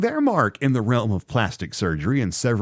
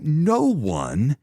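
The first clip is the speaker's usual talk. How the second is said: normal talking voice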